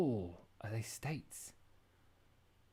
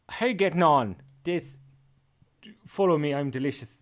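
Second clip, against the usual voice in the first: loud, hammed-up Irish accent; big mock Irish greeting; 4 semitones higher, 11 dB louder than usual. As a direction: imitate an Irish TikToker, loudly. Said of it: "he's so Irish".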